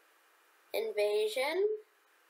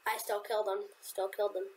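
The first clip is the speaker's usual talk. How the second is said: ordinary talk, nothing marked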